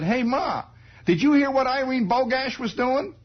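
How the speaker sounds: put-on high, loud excited boy; boy telling his mother the gossip, high and eager; eager kid voice. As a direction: blurt it high to Ma.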